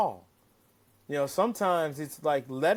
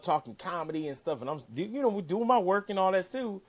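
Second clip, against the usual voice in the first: normal talking voice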